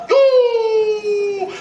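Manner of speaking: high, loud joyful yell; excited yell. Retold or imitated. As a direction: yell out in delight. Crowd cheering.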